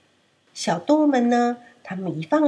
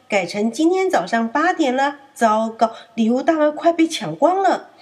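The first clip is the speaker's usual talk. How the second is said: sly urging; 5 semitones higher, 4 dB louder than usual. Acted. Wolf tricking lamb.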